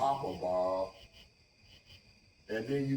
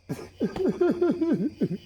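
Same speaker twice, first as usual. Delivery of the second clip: long, high-pitched laugh; giggling laughter. Real, not acted. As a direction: laugh in a high, rippling run. Laughs after own joke.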